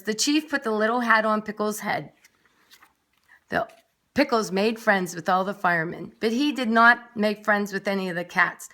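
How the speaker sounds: plain reading voice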